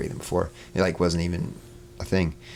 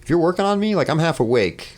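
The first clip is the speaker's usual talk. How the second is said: high, tentative mock call for attention; retold plea in a raised pitch; alerting people; 9 semitones higher, 3 dB louder than usual. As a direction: pipe up high to get their attention. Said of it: "trying to alert these people".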